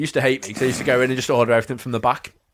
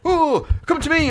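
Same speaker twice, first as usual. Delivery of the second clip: mock seller's call. Aping stall sellers beckoning visitors, high sing-song call. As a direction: beckon like a market trader, way up high.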